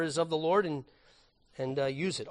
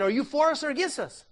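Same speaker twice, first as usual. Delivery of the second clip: retold yell; 7 semitones higher, 3 dB louder than usual. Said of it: "he yells out to the man".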